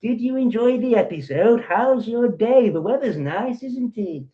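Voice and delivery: high, loud mocking small-talk voice; mock chit-chat voice. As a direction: parody a chatty neighbour gossiping about soaps. Mocking small talk about soaps.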